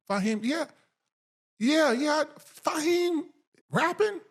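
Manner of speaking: high, incredulous "Fahim rapping?"; disbelief. Retold or imitated. Host recalling his surprise at the news, high incredulous. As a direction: replay the shock of hearing the name. Retelling his shocked reaction.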